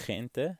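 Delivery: GENTE with a throaty kh at the start; Dutch-like G. Shown as a demonstration. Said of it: "it's like a Dutch G".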